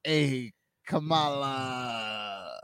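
slow, drawn-out Kamala; Kamala Harris voice. Acted. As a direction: stretch out the name slowly. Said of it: "And go".

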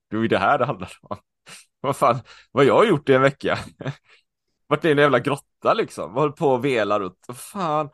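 animated, exclaiming to himself; reliving excited self-talk. Retold or imitated. Runner talking to himself, exhilarated and swearing. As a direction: buoyant self-talk, livelier pace. Retold inner outburst while running.

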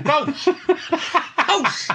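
squeaked out high through laughter; laughing falsetto squeak. Real, not acted.

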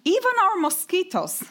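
measured, slightly lifted reading voice for an audience; memoir reading. Acted. Author reading her memoir to a literature audience, measured and lightly amused. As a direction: read the page aloud to the hall, lightly amused.

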